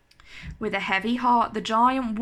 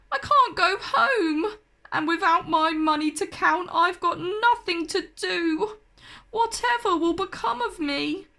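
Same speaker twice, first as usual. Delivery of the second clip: higher, tearful wail; crying giant; 6 semitones higher than usual. A narrator's reading. The stranded giant laments he can't go home, crying. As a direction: sob it out, all hope gone.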